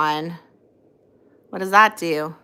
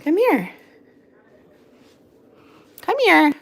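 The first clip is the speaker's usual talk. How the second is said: calling the dog; 9 semitones higher, 4 dB louder than usual. Real, not acted.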